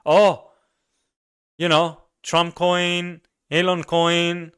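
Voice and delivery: high, slow, proud mock voice listing coins; mocking crypto bro. Crypto guy proudly answering what he buys, high slow mock voice. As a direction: show off the coins like treasure, lightly mocked.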